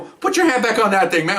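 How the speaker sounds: high-pitched urgent retold command; urgent order. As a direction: act out a cop ordering a bleeding man. Officer urgently orders bleeding man.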